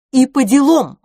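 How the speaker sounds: high, loud, combative agreement; militant. A narrator's reading. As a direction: back her up like a war cry. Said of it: "воинственно подтвердила мисс Петтигрю". (воинственно, громко)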